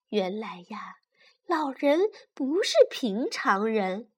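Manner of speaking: plain reading voice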